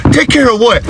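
high-pitched, startled demand; alarmed question. Acted. Dad questioning daughter's threat, high alarm.

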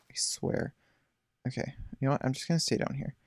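ordinary talk, nothing marked

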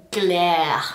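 emphatic disgusted "Glare"; disgusted punch. Shown as a demonstration. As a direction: hit "Glare" hard with disgust. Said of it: "as bad as it sounds".

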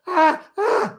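very high frantic yelps; hammed-up frozen panic; mock panic scream. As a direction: shriek in helpless panic.

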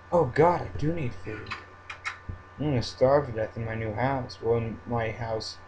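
usual speaking voice

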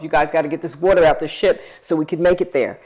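rally the crew to get the water out; calling out to crew. Retold or imitated.

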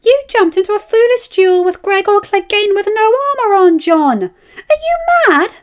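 wife furious at her reckless husband, shouting high; furious scolding wife. A narrator's reading. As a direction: tear into him for risking his life.

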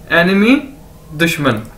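ordinary talk, nothing marked

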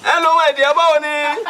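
high, chuckling hello to a woman; flirty greeting. Acted. Boss flirts with card seller.

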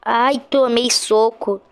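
normal talking voice

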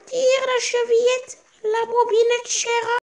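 put-on high, slow old woman's call from bed; old grandmother calling out. Sick grandmother in bed calls to the visitor, high and slow. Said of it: "lui cria".

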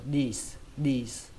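"dis" as đi plus a hiss; "dis" like Vietnamese đi ending in s; added final s. Shown as a demonstration. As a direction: close "dis" on a clear s. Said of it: "lấy chữ đi và thêm âm sờ".

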